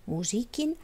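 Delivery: plain reading voice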